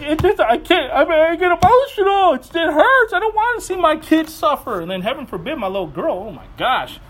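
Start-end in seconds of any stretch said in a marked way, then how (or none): 0.0-4.8 high, worked-up mock tearful plea; imitating emotional crying. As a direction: plead as an overwhelmed dad, mildly hammed-up. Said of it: "I'm crying".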